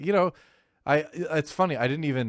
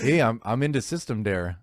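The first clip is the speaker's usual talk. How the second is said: light mock Canadian accent. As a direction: lightly play up a folksy Canadian. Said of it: "He didn't sound Canadian enough".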